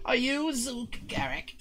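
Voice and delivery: high, slow kobold asking; small creature piping up carefully, slowly. Acted. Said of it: "And he goes".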